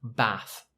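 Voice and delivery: soft Bath with a short flat a. Shown as a demonstration. Teacher repeats the northern short a Bath. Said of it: "a bit more like this".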